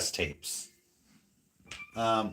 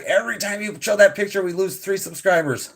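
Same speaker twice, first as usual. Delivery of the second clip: high, quick imitation of a complaining co-host; mock nagging; 8 semitones higher than usual. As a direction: mimic her complaint in a higher voice. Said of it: "She's like".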